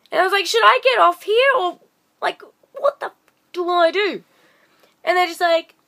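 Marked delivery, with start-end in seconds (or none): 0.5-4.2 high, flustered mock panic; retold panic. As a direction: ask like you're losing it. Panicked question on the train.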